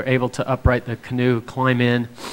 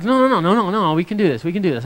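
insistent refusal; 6 semitones higher, faster than usual. Retold or imitated. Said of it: "I'm like".

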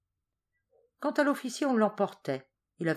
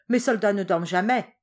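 loud, commanding shout; a general shouting his pride; 4 semitones higher, 9 dB louder than usual. A narrator's reading. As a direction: bark it at the consul. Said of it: "cria Garcia".

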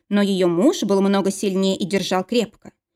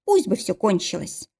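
ordinary delivery, nothing marked

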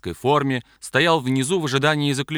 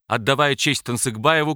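ordinary delivery, nothing marked

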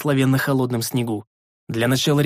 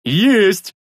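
higher, louder triumphant bark; joyful bark; 7 semitones higher, 6 dB louder than usual. A narrator's reading. Rikar barks with joy over the knife, triumphant. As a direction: shout in triumph. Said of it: "обрадованно рявкнул Рикар".

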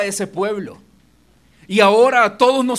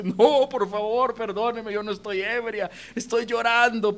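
imitated tearful pleading; 3 semitones higher, 3 dB quieter than usual. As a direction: plead like a woman weeping, lightly acted. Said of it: "la mujer llorando le dice".

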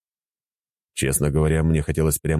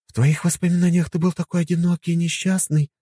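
higher woman's voice, sympathetic; sympathetic wife. Acted. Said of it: "сказала Катя". (сочувственно)

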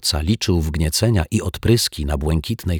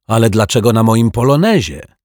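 louder, higher exasperated wail; 5 semitones higher, 7 dB louder than usual. Acted. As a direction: protest at the injustice. Bitter caretaker complains. (rozżalony dozorca krzyczy o samochodzie)

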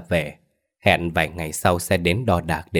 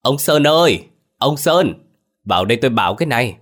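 high, loud call to a husband; raised summons, quickening at the end; 5 semitones higher, 4 dB louder than usual. A narrator's reading. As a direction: holler his name out to the garden. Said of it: "vọng ra vườn".

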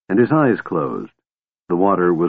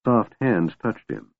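plain reading voice

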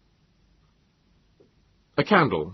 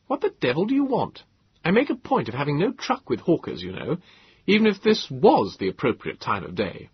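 put-on suspicious young man; 7 semitones higher than usual. A narrator's reading.

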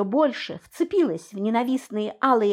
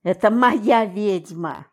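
slow, louder possessive growl; 4 dB louder, slower than usual. A narrator's reading. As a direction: growl it like a claim. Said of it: "зарычал Генрих".

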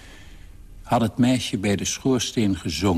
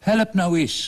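high, urgent plea for help; calling out; 10 semitones higher than usual. A narrator's reading. Father tugging at the leg, calling his daughter to help. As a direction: raise the pitch and call for a hand. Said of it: "riep hij".

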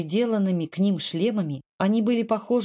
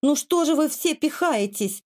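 high, aggrieved mild wail; hurt yelling; 7 semitones higher than usual. A narrator's reading. Kvardli shoved aside on the stairs, a mild offended yell. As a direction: complain in an offended, higher voice. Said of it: "обиженно завопил штандартенфактор".

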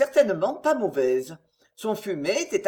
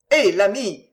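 louder, jovial call to the innkeeper; hearty friendly greeting, louder; cheerful hail; 7 dB louder than usual. Acted. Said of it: "dit-il gaiement".

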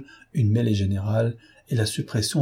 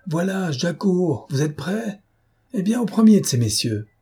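taunting call; 8 semitones higher than usual. A narrator's reading. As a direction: call out mockingly that you're coming. Lupin calls out to the attackers.

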